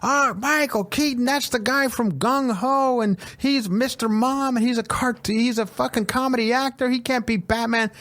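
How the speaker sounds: high, whining protest; mock outraged fans. Imitating people upset about Michael Keaton's casting, mock outrage. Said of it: "People were".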